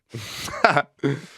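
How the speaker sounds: short amused laugh; light laugh. Real, not acted. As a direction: laugh at the joke. Laugh after punchline.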